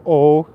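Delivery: "eau" said as a plain French O sound, loud; French pronunciation. Shown as a demonstration. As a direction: say "eau" like a simple O, punched. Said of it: "three vowels and none of them are O".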